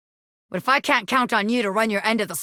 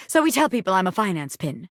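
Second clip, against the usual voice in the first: ordinary talk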